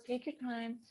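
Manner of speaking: imitating a patient therapist, hushed; soft therapist. Therapist soothing a stuck client, soft and high. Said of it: "she goes".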